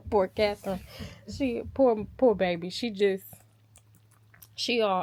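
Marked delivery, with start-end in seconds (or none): none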